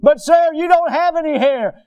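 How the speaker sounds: high, loud whining protest; mock crying boy. Preacher imitates recruit answering sergeant about hair, high mock whine. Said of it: "this boy was just crying".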